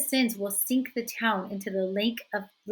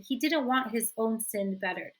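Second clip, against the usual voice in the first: ordinary delivery, nothing marked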